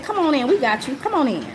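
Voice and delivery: warm welcome. Retold or imitated. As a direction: welcome them in like an overjoyed host.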